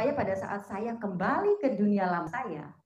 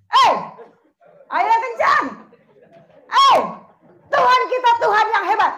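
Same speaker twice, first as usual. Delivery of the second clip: loud and higher, whipping up the crowd; rousing shout; 9 semitones higher, 13 dB louder than usual. Real, not acted. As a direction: call out loud to wake them up. Preacher rousing crowd to clap.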